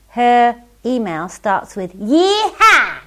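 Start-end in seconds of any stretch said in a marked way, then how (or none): 2.0-3.0 loud, high hammed-up American cowboy whoop; cheesy American accent cheer; American yeehaw. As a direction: whoop it like a mock Texan cowboy. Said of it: "so that you don't think that I can't do an American accent".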